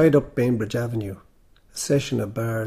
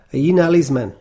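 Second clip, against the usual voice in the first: raised, louder question, aiming for confidence; higher, louder attempt at confidence; trying to sound confident; 4 semitones higher, 9 dB louder than usual. A narrator's reading. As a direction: call it out as if sure of yourself. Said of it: "I tried to sound confident".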